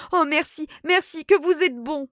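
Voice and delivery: high, loud relieved thanks; grateful exclamation. A narrator's reading. Cousin thanking the narrator who promised money, overjoyed. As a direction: burst out with relief and gratitude. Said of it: "Elle s'écria".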